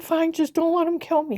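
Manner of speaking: high, loud pleading cry; desperate plea. Retold or imitated. Woman begging not to be left to killers, desperate plea. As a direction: plead loudly as if cornered.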